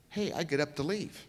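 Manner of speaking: ordinary talk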